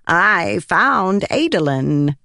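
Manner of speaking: slow, drawn-out odd voice; proclaiming spren. Acted. Pattern proudly presenting Adolin, slow and odd. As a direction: announce the name like a strange spirit. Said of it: "he proclaimed".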